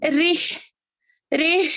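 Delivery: रिह said loud and high with a strong throaty ह; stressed रिह. Shown as a demonstration. Teacher drills रिह for students, loud and high. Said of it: "यहाँ पर".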